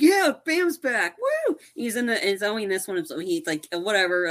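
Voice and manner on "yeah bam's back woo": high, drawn-out celebratory whoop; retold fan's excited holler; excited cheer. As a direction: cheer a favourite's return. Reenacting cheer at seeing Bam.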